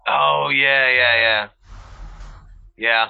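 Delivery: normal talking voice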